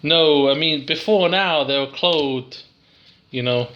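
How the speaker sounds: ordinary talk, nothing marked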